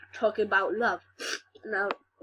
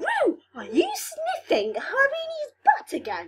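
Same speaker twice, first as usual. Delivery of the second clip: much higher shocked voice, laughing through it; squeaky scandalised girl. Retold or imitated. Deanna catching Miss Mulgrew, high giggly squeal.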